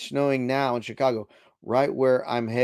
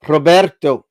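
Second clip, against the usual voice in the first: Roberto with a rolled r, loud and proud, ro-BER-to; Spanish pronunciation; 4 semitones higher, 12 dB louder than usual. Shown as a demonstration. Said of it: "I think it was Roberto".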